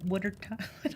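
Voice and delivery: Philadelphia accent, wooder; broad Philly accent on water. Real, not acted. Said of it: "you just heard my Philly accent".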